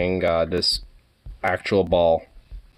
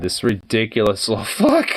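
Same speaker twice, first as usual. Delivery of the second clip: normal talking voice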